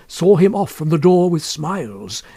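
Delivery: ordinary delivery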